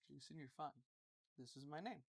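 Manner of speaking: soft, low imitation of a dad; not screaming. Friend's dad letting the boy off, quiet and low. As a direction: keep it hushed and even. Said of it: "only time I never heard him scream".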